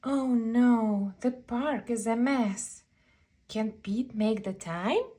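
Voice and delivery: plain reading voice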